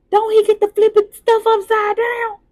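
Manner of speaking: high, loud, rising preacher cry; excited preaching. Acted. Preacher to the congregation, crying out high and loud. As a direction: lift it up like a revival peak.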